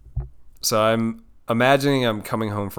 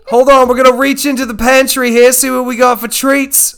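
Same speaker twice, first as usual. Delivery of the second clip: loud, high-pitched Australian showman; excited Aussie nature host presenting a wild catch; Steve Irwin; 8 semitones higher, 6 dB louder than usual. Acted. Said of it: "Steve Irwin curses a lot".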